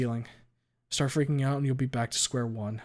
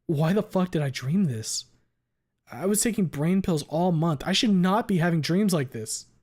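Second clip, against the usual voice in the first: agitated; 5 semitones higher, 5 dB louder than usual. A narrator's reading. Frantic over the dream.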